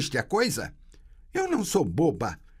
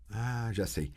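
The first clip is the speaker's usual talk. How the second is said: usual speaking voice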